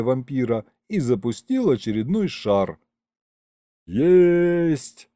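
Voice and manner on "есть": high cry of triumph; raised, elated shout; triumphant yell. A narrator's reading. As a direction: cheer a direct hit. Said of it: "завопил Колымдай".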